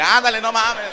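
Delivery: loud, high, frantic "ándale"; exasperated shout. Acted. Husband shouting at the crying baby's timing, a frantic yell. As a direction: yell it in comic panic. Wife running, pants down.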